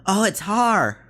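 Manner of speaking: high, loud, delighted cry; excited recognition. Acted. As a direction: exclaim it as someone suddenly spotting a friend. Character greets Haar on seeing him.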